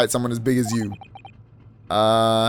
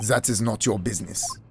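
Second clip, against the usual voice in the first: put-on low, soft, dismissive accented voice; curt accented witness; 5 semitones lower, 6 dB quieter than usual. Witness refuses the hero's question, low curt accented voice. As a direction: brush him off coldly. Voicing accented suspect in game.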